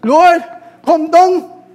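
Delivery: high, loud cry to heaven; pleading call. Retold or imitated. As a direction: call up to the sky, begging. Mock prayer calling out.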